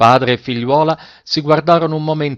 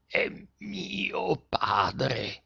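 very slow, hushed, higher-pitched strange voice; strange, off-key voice; 5 semitones higher, 13 dB quieter, much slower than usual. A narrator's reading. Deaf girl speaks to her father, strange off-key voice. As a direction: labour out each word clearly, oddly pitched. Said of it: "con una voce grossa, strana, stuonata".

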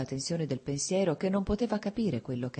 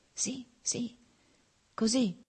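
slow, broken; 8 semitones higher, slower than usual. A narrator's reading. Dying man speaks to himself in slow halting words. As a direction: let each word fall after a pause. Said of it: "pronunciò a intervalli lentamente il moribondo".

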